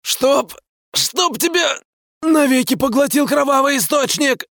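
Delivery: very high, strained stammering rasp; wheezing rasp. Acted. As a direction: choke the insult out hoarsely. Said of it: "прохрипел он".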